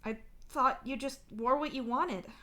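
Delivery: insist, but without raising your volume. A narrator's reading. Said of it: "you insist".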